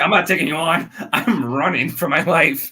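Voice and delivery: high, loud comic refusal to fight; mock panic. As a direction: play a character bailing out in a hurry. Voicing a character fleeing in comic panic.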